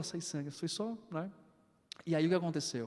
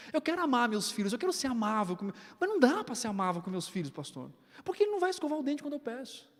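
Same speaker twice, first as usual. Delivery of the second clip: higher, mock whiny wife voice, faster at the end; complaining wife; 6 semitones higher, faster than usual. Pastor mimics a wife complaining about her kids, hammed-up whine. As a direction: playfully imitate a fed-up mother griping. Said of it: "A esposa pensando".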